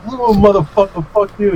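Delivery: high-pitched exasperated cursing; high, put-upon mock fury; retold pissed off. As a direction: curse them in replayed frustration. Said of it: "I was, I was pissed off".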